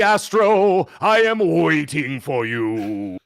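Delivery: hollow, slow reaper voice; a hint of a looming spectre; ghost voice. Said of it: "you kinda sound like the ghost of Christmas Past".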